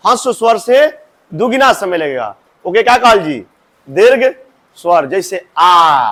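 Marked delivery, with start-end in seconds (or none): 5.1-6.1 आ drawn out slowly and long; long vowel. Shown as a demonstration. Teacher showing the long vowel आ, slowly stretched. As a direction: hold आ twice as long as अ.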